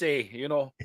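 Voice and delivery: hammed-up Upper Peninsula accent with a tagged-on "eh"; mock northern Midwest accent. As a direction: put on a Yooper accent. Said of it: "talk like this".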